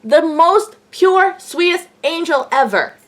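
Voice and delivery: high, slow, emphatic gushing; gushing adoration. Real, not acted. Gushing praise of her idol.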